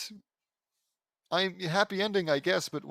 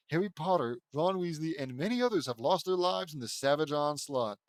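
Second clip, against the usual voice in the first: plain reading voice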